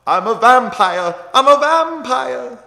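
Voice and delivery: high, loud hammed-up wail; unhinged movie actor with a bizarre put-on accent, drawn out; Nicolas Cage. Fan quoting Vampire's Kiss to viewers, wildly over-the-top impression. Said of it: "I don't know what accent he's trying to pull off".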